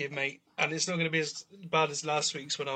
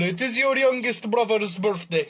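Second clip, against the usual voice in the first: higher, hammed-up Eastern European voice; Eastern European accent; 5 semitones higher, 3 dB louder than usual. Host toasting a brother's birthday with Jodka, mock Eastern European accent. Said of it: "in an Eastern European accent".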